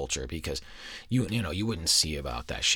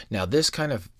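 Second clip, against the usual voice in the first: usual speaking voice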